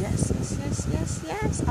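climbing high, excited yeses; rising squeal of delight. Real, not acted.

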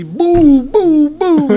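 loud, high booming shots; booming vocal gun noises; imitated gunshots. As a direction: mimic three rifle blasts.

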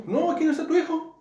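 high, put-on voice for Eusebio; high-pitched retort. Eusebio turning away the father looking for his son, high-pitched retort.

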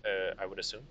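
ordinary delivery

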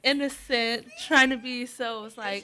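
shouting through laughter; laughing loudly. Real, not acted. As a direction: laugh hard while you accuse. Accusing friend while laughing.

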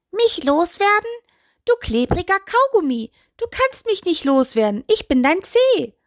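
high, affronted toe character voice; indignant. A narrator's reading. Toe refuses to be got rid of by the witch, indignant. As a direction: protest in a high, scolding toe voice.

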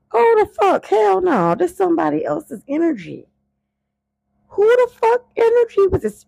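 high-pitched, loud shocked outburst; shocked outrage. Retold or imitated. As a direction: squeal it out in disbelief and indignation. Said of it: "And I'm like".

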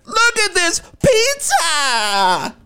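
very high, drawn-out frontman shriek. Acted. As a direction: announce it like a stadium singer. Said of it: "all of his tweets were like".

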